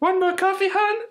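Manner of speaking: very high mock waitress voice; hammy diner waitress. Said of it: "waitress goes".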